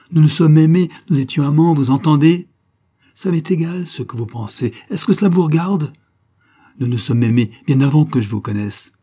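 loud, high, angry outburst; beside herself. A narrator's reading. Wife harassed by her husband confesses love, beside herself. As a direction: hurl the confession in their face. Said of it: "hors d'elle d'être ainsi harcelée".

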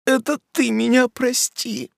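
put-on weeping young woman; sobbing. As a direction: sob out the apology. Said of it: "прорыдала".

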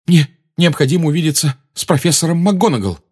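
breathless, urgent blurt; urgent panting report. A narrator's reading. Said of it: "задыхаясь, выпалил Гарри".

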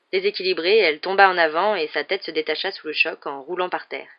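ordinary delivery

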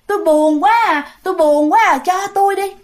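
high, plaintive whine; sad pleading ghost. Acted. Lonely ghost woman begs mother for the child, plaintive. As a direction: lament in a high even voice, then beg. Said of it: "bả buồn".

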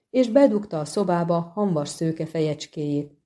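ordinary delivery, nothing marked

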